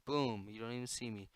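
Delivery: ordinary talk, nothing marked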